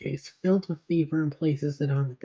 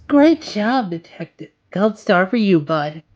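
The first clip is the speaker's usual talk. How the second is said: loud, higher mock cheer; mocking praise; 4 semitones higher, 11 dB louder than usual. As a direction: gloat at him like a proud teacher. Harley mocking Batman loudly.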